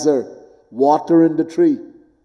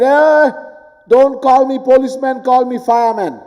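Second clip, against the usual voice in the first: higher, louder pretend protest; a policeman fussing over his title; mock objecting policeman; 8 semitones higher, 3 dB louder than usual. Policeman objects to his own name, mock indignant.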